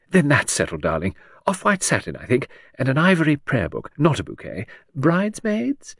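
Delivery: lilting high society lady; chattering aristocrat. Acted. Hostess prattling to Edward about the wedding.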